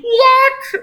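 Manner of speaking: loud, high squeal of disbelief; real outburst, voice shooting right up; incredulous. Real, not acted. Reader cannot believe the fanfic, loud high incredulous cry. Reader reacts to absurd story.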